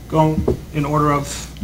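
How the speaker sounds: normal talking voice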